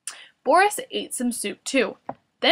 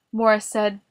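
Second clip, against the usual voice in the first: ordinary delivery, nothing marked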